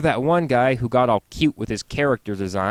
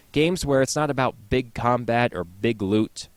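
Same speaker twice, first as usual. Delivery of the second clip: usual speaking voice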